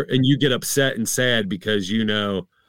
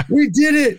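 high, loud squeal of victory; mock triumph; 6 dB louder than usual. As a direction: cheer as if you won the lottery. Acting out joy at saving pennies.

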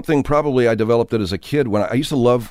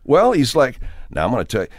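ordinary delivery

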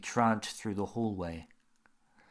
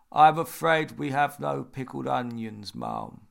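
lightly voiced gloomy old butler; a hint of a voice from the grave; sepulchral voice; 4 semitones higher, 7 dB louder than usual. A narrator's reading. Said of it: "His voice was sepulchral".

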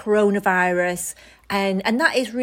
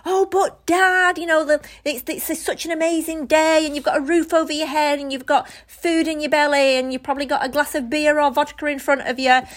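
mock upbeat pep talk; 7 semitones higher than usual. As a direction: lay the positivity on thick, pitched up. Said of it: "I'd give him all that".

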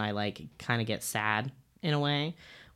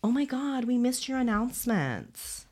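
high, gushing and slowed; excited coworkers; 11 semitones higher than usual. Retold or imitated. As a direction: gush it like a thrilled coworker. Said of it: "they were all like".

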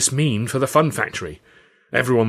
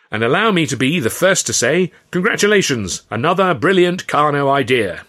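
loud, raised gushing praise; gushing flatterer; 6 semitones higher, 6 dB louder than usual. Acted. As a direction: lay the congratulations on thick. A flatterer toasts Carno.

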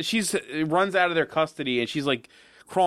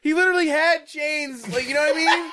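laughing protest; 11 semitones higher than usual. Real, not acted. Arguing the hero had chains, high laughing protest. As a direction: insist through a laugh.

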